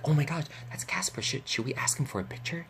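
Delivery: hushed, voiceless retold huddle; acted secretive whispering; conspiratorial whisper. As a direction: whisper it to friends so he won't hear. Fans conferring secretly about a celebrity.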